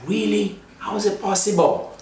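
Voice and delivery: high, astonished "Really?"; surprised. Retold or imitated. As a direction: act amazed. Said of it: "I said".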